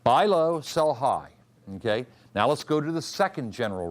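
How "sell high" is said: ordinary delivery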